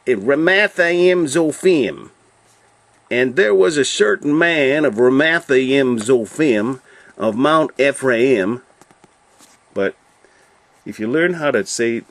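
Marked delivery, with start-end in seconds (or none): 0.1-8.6 slow, loud southern drawl, pitched up; stretched-out southern preacher-style accent. Shown as a demonstration. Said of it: "if you had a southern accent".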